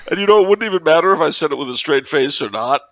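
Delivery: usual speaking voice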